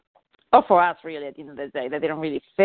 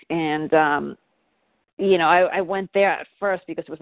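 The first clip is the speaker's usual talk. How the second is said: ordinary talk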